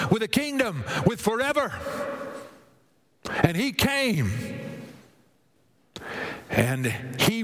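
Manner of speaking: high-pitched, ringing sermon delivery; rousing preacher's cadence; impassioned preaching. Real, not acted. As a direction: proclaim it to the whole congregation.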